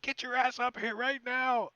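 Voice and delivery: high, loud bellowed order; drill sergeant yell. Retold or imitated. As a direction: bellow it at a lazy recruit. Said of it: "yelling like a drill sergeant".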